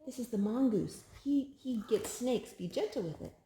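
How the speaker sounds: ordinary delivery, nothing marked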